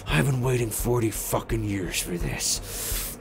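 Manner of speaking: low, soft menacing vow; old sheriff quietly vowing revenge on the killer; low vengeful line. Retold or imitated. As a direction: murmur it right before firing. Sheriff aims shotgun at killer.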